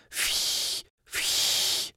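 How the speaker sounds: breathy blow through pursed lips, no voice; soft blowing puffs. A narrator's reading. Narrator blowing up the bubble, soft unvoiced puffs. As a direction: blow air like inflating a bubble. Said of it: "s každým dalším fouknutím".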